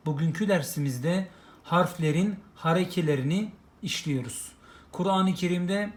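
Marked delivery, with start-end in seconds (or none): none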